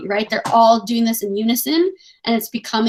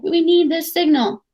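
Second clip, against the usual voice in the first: higher, desperate plea; a desperate boy pleading; 7 semitones higher than usual. Retold or imitated. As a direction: push it out desperately. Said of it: "Piggy is, like, desperate".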